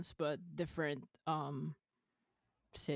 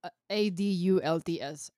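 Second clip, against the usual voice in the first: ordinary talk, nothing marked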